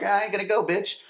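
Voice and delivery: high mocking brush-off; mock Brandon. Mock Brandon brushing off Andrea, high and cocky. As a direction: sneer it out as a teen. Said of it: "Brandon's like".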